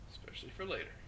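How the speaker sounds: ordinary talk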